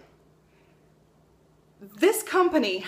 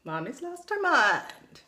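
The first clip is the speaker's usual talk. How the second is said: put-on slow, higher, wide-eyed child voice; kids' voice; 4 semitones higher, slower than usual. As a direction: say it like a stunned little kid. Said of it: "They're all like".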